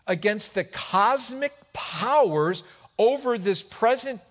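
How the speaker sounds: high, loud, emphatic preaching; forceful preaching. Real, not acted. Preacher expounding the devil's schemes to church, forceful preaching. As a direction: drive the scripture home, punching key words.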